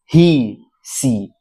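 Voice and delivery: ही, सी said slowly with the ee drawn out long; long ee demo. Shown as a demonstration. Teacher showing students the long ee, slowly. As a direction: stretch the ee in ही and सी, slowly. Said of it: "उसको ध्यान दीजिए".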